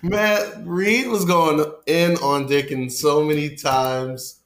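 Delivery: high, loud, drawn out through laughter; can't keep a straight face; real amused laughter. Real, not acted. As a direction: crack up while recounting it. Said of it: "I shouldn't laugh".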